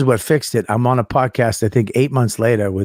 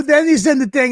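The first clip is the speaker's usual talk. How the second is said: high-pitched flustered babble; acted-out nervous spluttering; retold panic; 11 semitones higher than usual. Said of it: "I panicked".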